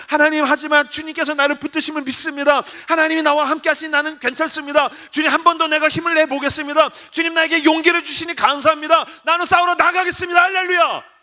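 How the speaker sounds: rousing prayer climaxing in exultation; turning to praise. Acted. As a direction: declare faith with rising resolve. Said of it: "찬양으로 바뀌는 거예요".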